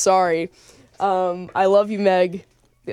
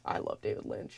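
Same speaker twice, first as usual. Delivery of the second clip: whispered fan confession; 12 semitones lower, 17 dB quieter than usual. Retold or imitated. Quoting her past fan self.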